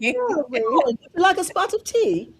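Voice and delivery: drawn-out British accent, playing the husband. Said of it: "Just like that".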